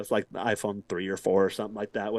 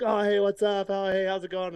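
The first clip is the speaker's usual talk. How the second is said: higher, bright mock-friendly greeting; cheery hello; 7 semitones higher than usual. Retelling greeting a neighbourhood guy, cheery and high. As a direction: greet him like a buddy you recognise. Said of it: "I'm like".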